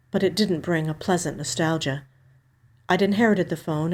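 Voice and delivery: usual speaking voice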